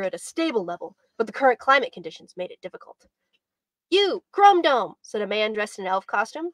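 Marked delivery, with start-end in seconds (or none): 3.9-4.9 brash street elf. Acted. Elf man calls out to robot.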